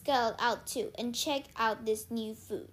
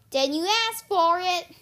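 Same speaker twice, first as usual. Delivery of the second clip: loud, raised warning; threatening; 6 semitones higher, 8 dB louder than usual. A narrator's reading. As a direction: warn her, louder and higher. Annabeth makes good her threat.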